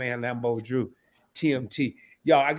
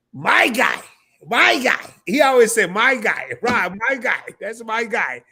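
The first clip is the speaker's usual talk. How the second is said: higher, louder imitation of his catchphrase; Lambo Drew impression; 6 semitones higher, 6 dB louder, slower than usual. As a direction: do your buddy's hyped greeting. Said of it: "I gotta say this in my Lambo Drew voice".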